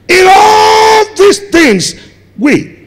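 high, loud, slow drawn-out refrain; preaching shout. Real, not acted. Preacher repeating the verse to the church, slow ringing shout. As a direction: hold each word long and ring it out.

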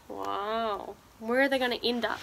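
ordinary delivery, nothing marked

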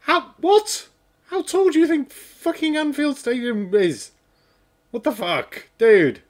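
can't believe the number he just read; incredulous. Real, not acted. Absurd stadium height claim.

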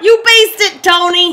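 high, loud cheering yell; excited shout. Real, not acted. Player cheers on the game batter Tony, excited shout. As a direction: holler it at the batter.

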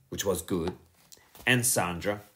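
usual speaking voice

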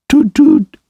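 very high breathless toot toot; a dazed tiny echo of the horn; dreamy murmur. A narrator's reading. Toad in a trance echoes the car horn, high and dreamy. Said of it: "he murmured".

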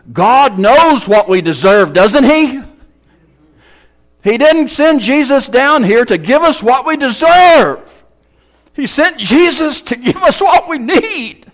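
loud, high preacher's climax; preaching at full force. Acted. Preacher rising on grace, loud and high. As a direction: drive the point home to the congregation.

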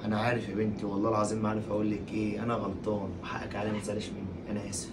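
very soft, low and fading; quiet, sunken, ashamed murmur; remorseful apology. Acted. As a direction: beg forgiveness in a hushed voice. Husband begs forgiveness.

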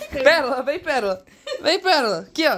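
high, laughing call to the dog. Real, not acted. Owner laughing while calling Pérola the dog over.

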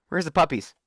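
ordinary talk, nothing marked

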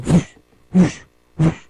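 airy imitated whoosh of passing cars. Shown as a demonstration. Storyteller imitating all these cars going by, whooshing. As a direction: blow out short, breathy whooshes.

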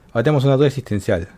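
ordinary delivery

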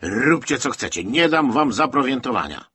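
exasperated, forceful dismissal at high volume; angry refusal. A narrator's reading. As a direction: shout them down. Colonel angrily refuses provisions.

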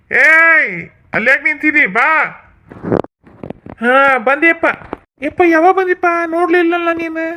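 high village father voice greeting his son; father calling son. Acted. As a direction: call across the field to your son, surprised to see him. Father figure voiced in skit.

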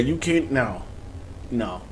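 normal talking voice

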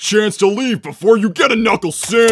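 loud, menacing warning; tough threat. Acted. Defender loudly threatening an unseen intruder. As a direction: bark a tough-guy threat into the dark.

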